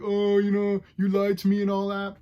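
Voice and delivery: mock her whining, nasal-free but exaggerated; mocking whiny quote. Reviewer mocking Cyclops lass's complaint, whiny mock voice. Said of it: "she's all like".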